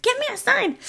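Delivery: high pitched plea to God; a mock desperate prayer; mock pleading. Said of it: "you're like".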